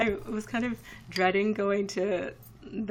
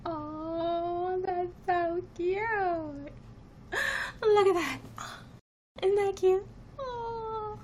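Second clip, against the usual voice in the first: high cooing coo over a cute picture; high-pitched aww voice; 11 semitones higher, much slower than usual. Real, not acted. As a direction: gush at it in a high voice. Cooing at her own snap.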